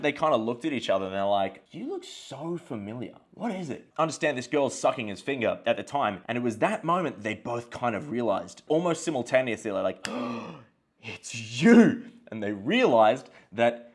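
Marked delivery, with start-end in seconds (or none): 1.7-3.9 high, soft puzzled voice; retold wondering, hushed and high-pitched; puzzled recognition. 10.1-12.0 mock gasp, then a loud realisation; exaggerated retold shock; shocked recognition. Retold sudden mutual recognition.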